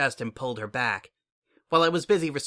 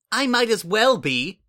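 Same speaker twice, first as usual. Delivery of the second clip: sharp, loud and higher retort; snapped; 9 semitones higher, 6 dB louder than usual. Acted. Sunset retorting to the spy who doubts her rank, loud snap. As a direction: bite the words back at her. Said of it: "I snapped".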